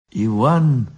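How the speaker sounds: ordinary delivery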